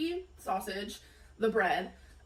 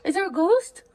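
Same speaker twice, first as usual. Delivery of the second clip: higher, louder teasing to the dog; playful pet talk; 6 semitones higher, 5 dB louder than usual. Real, not acted. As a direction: ask the dog about the ghost, playful.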